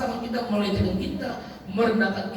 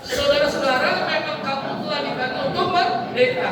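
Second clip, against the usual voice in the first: higher-pitched, impassioned sermon delivery; fervent preaching; 6 semitones higher than usual. Acted. Preacher urging couples to serve with love, fervent. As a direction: preach it with rising urgency.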